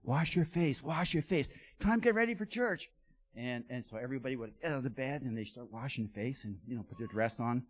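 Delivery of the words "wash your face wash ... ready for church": loud, high call to wake everyone; pastor calling out. Retold or imitated. Pastor waking the village on Sunday morning, calling out loudly. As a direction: rouse the whole village like a pastor at dawn. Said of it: "he would say".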